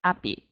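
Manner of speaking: "Happy" said as "appy"; French dropped H. Acted. Teacher repeats the francophone mistake, H dropped. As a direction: leave the H off "Happy". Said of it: "can't pronounce the H sound".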